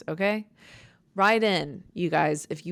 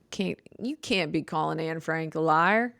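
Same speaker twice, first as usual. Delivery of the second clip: normal talking voice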